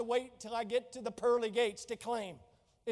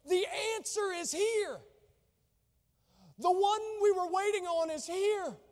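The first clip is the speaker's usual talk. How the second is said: higher, bright with joyful news; excited Martha; 8 semitones higher, 3 dB louder than usual. Retold or imitated. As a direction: announce the long-awaited arrival with rising excitement. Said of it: "as if Martha is telling Mary".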